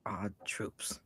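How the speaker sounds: low, slow whisper; hushed aside. Pretend. Co-host seconding the shout-out to the troops, slow whisper. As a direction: whisper it slowly.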